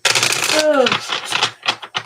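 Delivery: long breathy yawn; big yawn. Real, not acted. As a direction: let out a real yawn. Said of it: "I can't believe I'm yawning".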